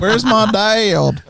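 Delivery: put-on high, very slow Southern child; Southern accent. Dad mimicking his three-year-old, Southern accent. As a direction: drawl it high like a little Southern kid. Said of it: "now has a Southern accent".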